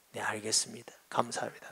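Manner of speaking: soft whispered thanks through tears; tearful whisper. Retold or imitated. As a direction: breathe the thanks out, voice almost gone. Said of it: "눈물을 흘려요".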